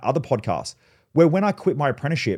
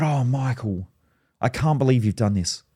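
normal talking voice

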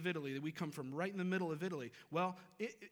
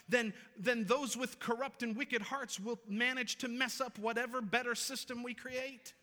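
higher, urgent pulpit warning; preacher's raised, pressing voice; impassioned preaching; 7 semitones higher, 4 dB louder than usual. Real, not acted. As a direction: drive the warning home to the congregation. Preacher drives the warning home.

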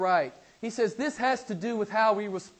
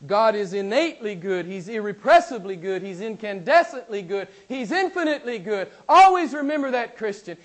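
loud, high preacher's cry; fervent pulpit proclamation; preaching at full force; 5 semitones higher, 9 dB louder than usual. Acted. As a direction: drive each "good" home to the church. Preaching builds up.

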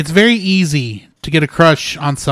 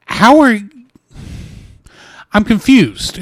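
baffled; 7 semitones higher, 3 dB louder, slower than usual. Real, not acted. Baffled by AI's behaviour.

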